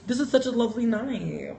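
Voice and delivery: gushing thanks to the viewers; sweet thanks. Real, not acted.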